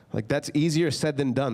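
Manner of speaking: usual speaking voice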